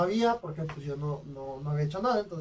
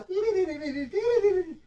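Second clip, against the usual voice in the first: high, trembling imitated flute trill; nervous flute noise; 13 semitones higher than usual. The narrator imitating Coque's nervous flute, high trembling trill. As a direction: play a shaky, nervous flute with your voice. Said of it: "bien nervioso el vato".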